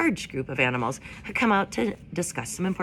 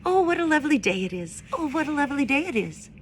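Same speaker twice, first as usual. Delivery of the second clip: higher, singsong little bird voice; cheerful chickadee; 9 semitones higher, faster than usual. A narrator's reading. Chickadees singing to Roz, higher and singsong. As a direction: lilt it like a happy songbird. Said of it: "when the chickadees sang".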